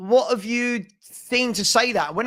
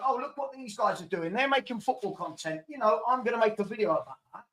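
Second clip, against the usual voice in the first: mocking imitation; 2 semitones higher, 8 dB quieter than usual. Streamer mimicking content creators who copy others, mocking. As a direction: quietly mimic a scheming copycat.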